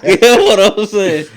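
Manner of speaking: high, loud laughing words. Real, not acted. Laughing after toddler quote.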